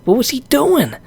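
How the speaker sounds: high incredulous questions; a fan's stunned tweet; retold outburst. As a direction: plead in disbelief. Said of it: "I said".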